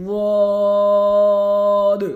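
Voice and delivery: full long واد; heavy long Zuad. Shown as a demonstration. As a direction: drag out واد with tongue sides on teeth.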